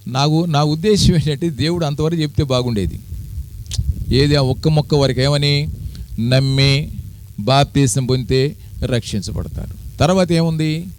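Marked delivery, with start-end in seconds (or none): none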